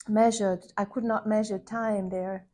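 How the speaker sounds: ordinary talk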